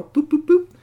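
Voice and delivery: three high, loud beeps; bright mechanical boops; high button beeps. Acted. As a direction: beep like tiny buttons. Robot pressing buttons sound.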